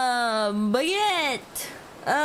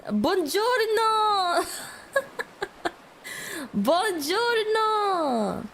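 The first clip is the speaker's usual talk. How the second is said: slow, higher hammed-up Italian buongiorno; mock Italian; 3 semitones higher, much slower than usual. Guessing a stranger is Italian, playful mock Italian. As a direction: drag out buongiorno like a tourist Italian. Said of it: "I thought she was, uh, Italian".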